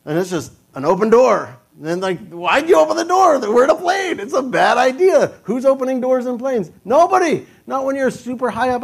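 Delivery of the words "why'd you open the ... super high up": high, loud comic alarm; panicked mock outburst. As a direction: protest in high-pitched, indignant panic. Acts out panic at open door.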